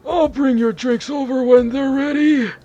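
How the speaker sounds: put-on high, slow shaky old man; a kindly old shopkeeper, drawn out. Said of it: "that old man voice".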